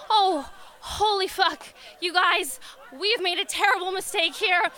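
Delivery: laughing alarm at his mistake; laughing panic. Retold or imitated. Panicked realization at parade.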